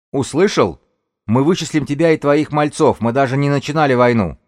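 high, loud warning; shouted threat. Acted. Woman leader warns the gang's elder, high loud shout. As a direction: project a threat to the far side.

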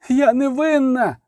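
high, drawn-out wail; slow, high-pitched cry of innocence. A narrator's reading. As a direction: wail it in protest, stretching the words. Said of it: "завила Аделя фон Стерча".

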